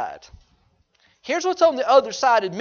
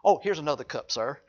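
appeasing waiter; 8 semitones lower, 7 dB quieter than usual. Retold or imitated. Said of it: "what does Josh want to do".

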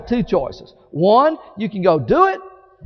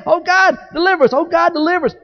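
higher pleading cry to God; desperate prayer; 6 semitones higher, faster than usual. Acted. Sailors rowing in the storm beg God, higher and pleading. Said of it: "they kept saying".

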